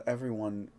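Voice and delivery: ordinary talk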